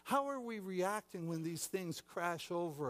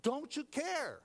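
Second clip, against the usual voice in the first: raised, higher voice demanding answers; angry disciples; 10 semitones higher, 4 dB louder than usual. Acted. Disciples angrily demanding the sleeping Jesus answer, loud and high. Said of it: "there was some anger involved".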